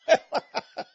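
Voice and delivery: quick scornful laugh; a brief laugh at his own point; short real laugh. Real, not acted. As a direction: laugh breaking out mid-sermon.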